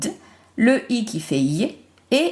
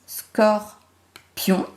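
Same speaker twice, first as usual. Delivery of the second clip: "Scorpion" in two separate beats, scor, pion; split into syllables. Shown as a demonstration. Teacher counting syllables for pupils, "Scorpion" syllable by syllable. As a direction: say "Scorpion" with a clear gap after "Scor". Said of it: "Deux syllabes".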